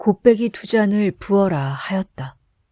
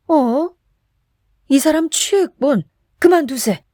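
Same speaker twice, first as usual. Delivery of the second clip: higher, startled voice urging him to stop; alarmed friend; 8 semitones higher, 4 dB louder than usual. A narrator's reading. Chisam tells drunk Kim to stop drinking, alarmed. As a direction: pull your drunk friend back, alarmed.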